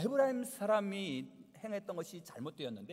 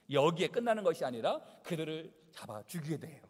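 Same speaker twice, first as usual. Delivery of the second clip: ordinary talk, nothing marked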